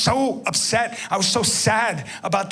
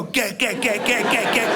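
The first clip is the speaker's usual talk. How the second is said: mock fight noises; 4 semitones higher than usual. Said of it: "forty people are watching me go".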